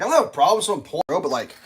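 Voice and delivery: ordinary talk, nothing marked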